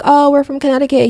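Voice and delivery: lightly imitated excitement; excited reply. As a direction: chirp it back, pleased to connect. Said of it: "we're like".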